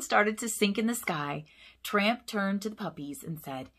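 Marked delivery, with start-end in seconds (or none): none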